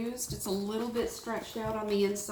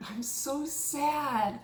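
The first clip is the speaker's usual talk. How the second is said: slow, higher, put-on pitiful whimper; 4 semitones higher, much slower than usual. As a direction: plead pitifully to win the clerk's sympathy. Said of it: "in my most pitiful voice".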